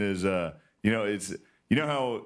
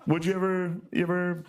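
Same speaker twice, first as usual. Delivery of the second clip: hesitant fishing question; 6 semitones higher than usual. Retold or imitated. Retold awkward question to girlfriend.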